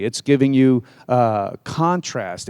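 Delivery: ordinary talk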